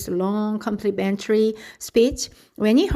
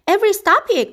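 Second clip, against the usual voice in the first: high, louder scold; mother crying out; 11 semitones higher, 4 dB louder, faster than usual. A narrator's reading. Mother scolds Avery for clowning, high and loud. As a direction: call out to stop him. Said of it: "cried his mother".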